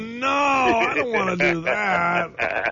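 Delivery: high, slow, drawn-out protest; mock whiny refusal. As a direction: whine it out like a joke complaint. Mock refusal of cold job.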